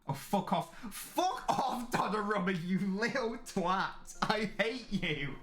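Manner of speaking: real anger. Real, not acted. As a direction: spit each insult out slowly. Furious at goalkeeper's time-wasting.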